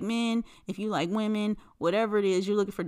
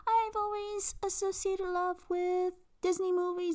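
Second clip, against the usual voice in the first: high, slow, soft fake Swedish accent; attempted Swedish accent; 11 semitones higher, 5 dB quieter, slower than usual. Host mimics woman talking of Disney love, attempted Swedish accent. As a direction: badly imitate a dreamy Swedish accent. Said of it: "I can't do a Swedish accent".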